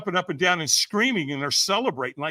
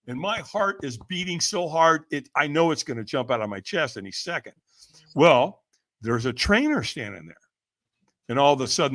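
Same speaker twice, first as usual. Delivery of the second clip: normal talking voice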